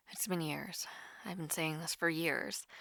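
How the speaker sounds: rueful whisper to herself; whispered aside. Acted. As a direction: drop to a private whisper. Self-deprecating aside about her internet.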